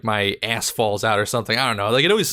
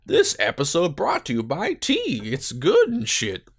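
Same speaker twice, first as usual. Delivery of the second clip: higher, hammed-up ad voice, slowing at the end; mock sponsor read; 6 semitones higher than usual. To viewers while drinking tea, mock ad read. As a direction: sell the tea like a fake sponsor.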